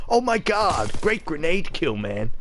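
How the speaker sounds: put-on lighter take on an American gamer accent; mock American accent. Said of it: "I can't stand that fucking accent".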